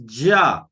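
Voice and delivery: ja said firmly on its own; ja, an emphatic lone syllable; stressed syllable. Shown as a demonstration. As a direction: land hard on ja. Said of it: "So there's a stress on".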